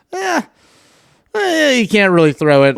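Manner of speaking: high-pitched, doubtful "eh"; mock dismissive reaction. Analyst voicing a team unsure of its quarterback's throwing, mock dismissive. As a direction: imitate doubters, pitched well up.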